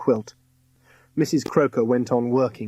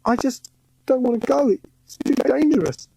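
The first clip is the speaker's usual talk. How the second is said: put-on frightened boy; 13 semitones higher than usual. A narrator's reading. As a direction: beg to stay behind. Scared boy pleading.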